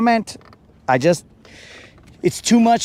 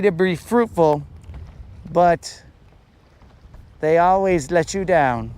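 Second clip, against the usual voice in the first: dramatic monologue; 3 semitones lower, 3 dB quieter than usual. Acted. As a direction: speak like a brooding poet. Solemn poetic speech on fishing.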